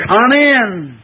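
high, loud drawn-out call to a visitor; Kentucky welcome call. Retold or imitated. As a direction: call out a hearty Kentucky come in. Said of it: "the old Kentucky expression".

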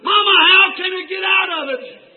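high, loud cry to mama; calling out. Acted. Eaglet in barnyard to mother above, calling out. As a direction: call up to someone far overhead.

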